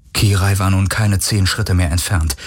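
ordinary delivery

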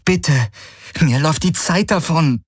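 high, pressing plea; urgent. A narrator's reading. Kirai urges Hawks that time is running out, high and pressing. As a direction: let the urgency lift the voice. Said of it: "Dringlichkeit ihrer Stimme".

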